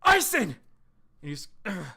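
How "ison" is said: high, loud alarmed cry; loud high panicked call; shouted name. Acted. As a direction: scream his name as he falls. Calling to a fallen friend.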